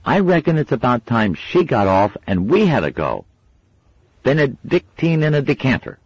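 put-on a kid sweaty and fed up; grumbling boy. A narrator's reading. Wensleydale complaining.

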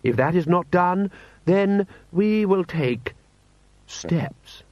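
high, slow lordly warning; pompous threat. Acted. Lord Rust warns a foreign prince, pompously menacing.

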